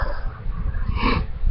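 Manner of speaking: soft acted intake of breath; startled gasp. A narrator's reading. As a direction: gasp at a frightening noise. Gorillas startled by the roar.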